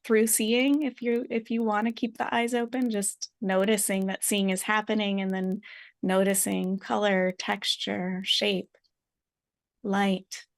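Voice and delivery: unhurried meditation guide, long pauses; guided meditation. Real, not acted. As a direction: gently invite listeners to notice, leaving space.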